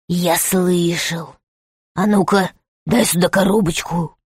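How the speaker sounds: low, menacing rat voice; rat man. Acted. The rat speaks, low and quiet.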